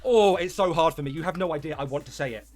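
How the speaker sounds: usual speaking voice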